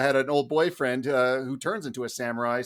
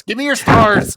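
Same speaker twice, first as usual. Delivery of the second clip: loud, high mock demand; 9 semitones higher, 15 dB louder than usual. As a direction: bark it like a greedy beggar. Demanding stars jokingly.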